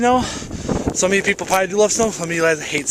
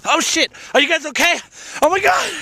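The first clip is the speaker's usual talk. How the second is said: startled panic; 9 semitones higher than usual. Real, not acted. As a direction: yelp as snow hits the lens. Snow falls on camera.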